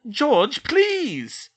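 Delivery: high, loud desperate plea; pleading. A narrator's reading. Mother pleading with her son, high and loud. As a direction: beg him to stop. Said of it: "Missus Weasley pleaded".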